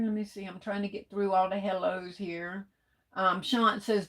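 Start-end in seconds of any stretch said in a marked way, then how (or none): none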